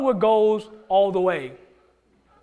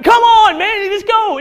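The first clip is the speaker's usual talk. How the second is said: high, very loud sports-crowd shout; excited fan yell; 12 semitones higher, 10 dB louder, slower than usual. Acted. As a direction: holler like a worked-up fan in the stands. Acting out fans at a game.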